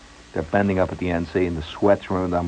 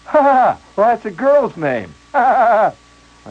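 mocking laugh; 12 semitones higher, 4 dB louder, slower than usual. Clerk mocks girl's name.

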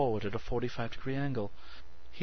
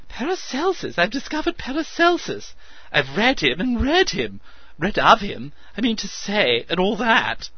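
excited; 11 semitones higher, 9 dB louder than usual. A narrator's reading. Meadows raving with discovery.

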